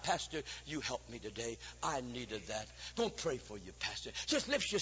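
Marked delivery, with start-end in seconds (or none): 0.0-2.7 grateful saint. Retold or imitated. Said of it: "thank God for that saint comes out".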